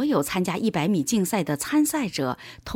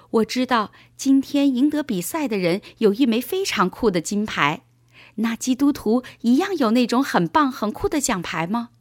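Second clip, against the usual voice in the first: put-on higher, eager boy's voice; curious young boy; 4 semitones higher than usual. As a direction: ask dad with boyish enthusiasm. Narrator voices boy Paul asking dad. (小男孩好奇地高声发问)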